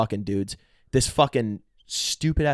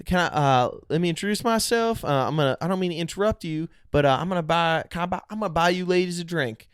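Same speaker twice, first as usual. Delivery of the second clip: mock Alabama suitor, pitched up; Southern guy; 6 semitones higher than usual. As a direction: imitate a Southern guy introducing himself to ladies. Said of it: "a dude from Alabama".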